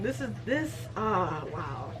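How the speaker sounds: ordinary delivery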